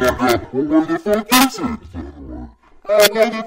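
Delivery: frustrated yelling. Real, not acted. Can't find tape recorder.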